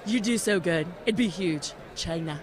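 a hint of Trump's voice; lightly voiced Trump; Trump impression. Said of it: "Wow, is that your Trump?".

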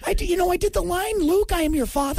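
high falsetto Luke line; squeaky mock actor. Host mocking the actor in high falsetto. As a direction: say it like a squeaky wimp.

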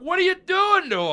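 high, loud protective dad yell; hammed-up angry father; outraged dad. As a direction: demand answers from the nurse. Dad confronting nurse.

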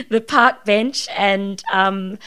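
ordinary talk, nothing marked

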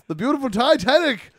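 loud high dock announcer; announcer call. Acted. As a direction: proclaim it to the crowd. Announcing ship departure.

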